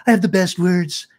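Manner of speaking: a light put-on bragging voice; boastful mock voice. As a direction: brag like a blowhard. Mocking boastful speaker.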